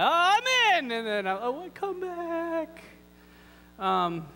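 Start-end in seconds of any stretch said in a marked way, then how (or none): 0.0-0.9 high, loud burst of eagerness; fired up. Acted. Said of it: "people get really fired up". 1.3-2.8 high, drawn-out call; mock calling back. Playfully calling back eager leavers.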